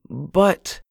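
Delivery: high, startled female protest; protesting wife. Acted. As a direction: cut off mid-objection.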